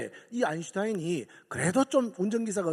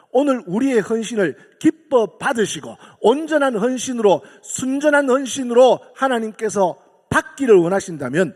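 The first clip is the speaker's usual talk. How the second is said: loud, ringing sermon cadence; full-force preaching; 8 dB louder than usual. Acted. Preacher rallies congregation, loud and fervent. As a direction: proclaim it to the whole hall.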